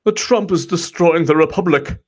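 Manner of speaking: high mock whiny voice; mocking critics. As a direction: sneer it out in a high whine. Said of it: "People are like".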